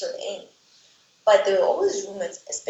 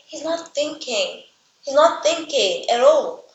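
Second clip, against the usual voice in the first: exasperated; 6 semitones higher, 4 dB louder, slower than usual. Real, not acted. Narrator exasperated at character's choices.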